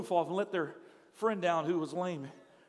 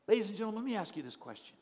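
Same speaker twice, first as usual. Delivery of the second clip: ordinary delivery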